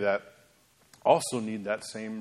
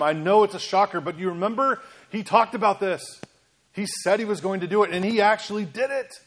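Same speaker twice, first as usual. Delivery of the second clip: pleading disciples; 4 semitones higher than usual. Acted. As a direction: beg Thomas to believe, rising in urgency. Disciples voiced pleading with Thomas.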